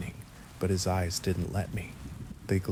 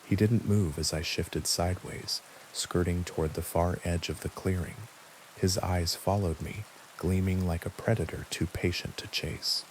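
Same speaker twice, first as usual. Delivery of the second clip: usual speaking voice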